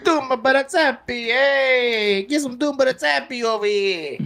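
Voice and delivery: high, exaggerated Italian caricature; hammed-up Italian accent. Mimicking co-host's sales pitch, hammed-up Italian accent. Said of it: "Your Italian really came out".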